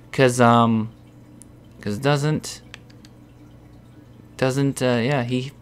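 usual speaking voice

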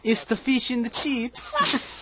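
slow, high mock British; bad British impersonation. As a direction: savour it in a silly British accent. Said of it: "Do your best British impersonation".

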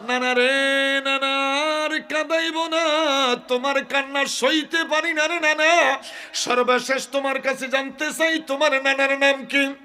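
high, loud wailing plea; anguished pleading. Acted. Husain begging grandfather over his tears, anguished plea. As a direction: beg loudly, stretching the line-ends.

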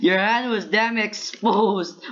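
high, drawn-out words broken by laughter; laughing gloat. Real, not acted. Player to opponent whose hand was exposed, laughing gloat. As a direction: crow it through fits of laughing.

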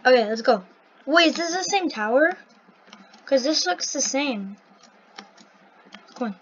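ordinary talk, nothing marked

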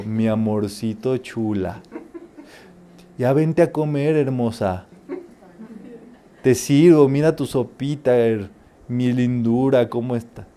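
mother sweet-talking her sulking daughter, softly. Retold or imitated. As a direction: coax her gently to come eat. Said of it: "No me hables bonito".

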